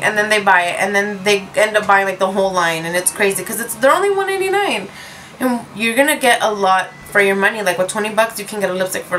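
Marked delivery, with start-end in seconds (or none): 3.7-4.9 excited about price. Real, not acted. Stressing a low price.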